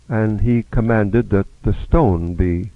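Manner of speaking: ordinary talk, nothing marked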